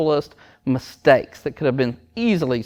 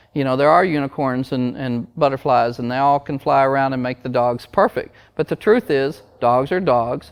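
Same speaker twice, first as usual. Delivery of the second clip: normal talking voice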